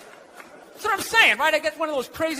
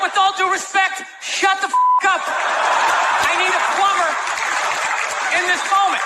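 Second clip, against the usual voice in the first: higher, raised, fed-up retort; mock exasperated shout; 5 semitones higher than usual. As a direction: snap back at the intruding wife. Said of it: "Wouldn't you be like".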